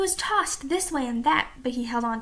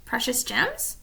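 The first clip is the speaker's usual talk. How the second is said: ordinary delivery, nothing marked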